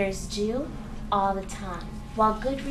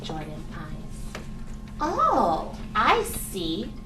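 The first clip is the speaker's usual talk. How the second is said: lower, slow, hushed story voice; reading to a toddler; 5 semitones lower, 3 dB quieter, slower than usual. Acted. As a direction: coax the little mouse gently. Reads storybook narrator to mouse.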